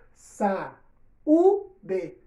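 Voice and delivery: saúde broken into sa, u, d with a pause after each; split into syllables. Shown as a demonstration. Teacher separating saúde for pupils, syllable by syllable. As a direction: chop the word into its syllables. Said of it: "O A se separou do U".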